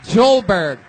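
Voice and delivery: rising announcer call, names drawn out; building band introduction. Real, not acted. Host announcing the band to the crowd, rising hype.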